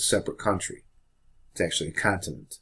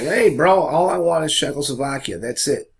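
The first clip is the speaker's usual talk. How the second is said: mock-innocent, high-pitched casual protest; hammed-up "who, me?" denial; Hitler; 5 semitones higher, 4 dB louder than usual. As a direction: play it cool, voice jumping up high. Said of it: "So Hitler's playing the game. Hitler's like".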